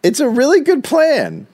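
high, strained insistence; squeaky defensive. Pretend. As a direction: squeak it out in protest. Defending his silly plan.

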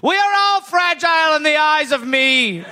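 high, grand heroic proclamation; rally the crates like a mock hero; heroic savior voice. Voicing Reg as crate hero.

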